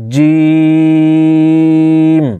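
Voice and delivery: "জিম" with a very long, level ee; তিন আলিফ টান. Shown as a demonstration. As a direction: hold the vowel of "জিম" for three measures. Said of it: "তিন আলিফ পরিমাণ টানতে হবে". ("জিম" তিন আলিফ লম্বা টেনে)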